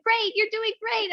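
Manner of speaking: a squeaky high cheer; high mock praise. To the class, mocking the roller coaster of praise, very high. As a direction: gush the praise far up in the voice. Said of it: "And then".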